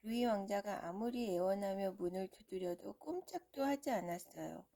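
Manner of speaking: usual speaking voice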